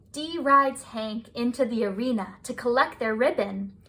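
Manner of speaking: plain reading voice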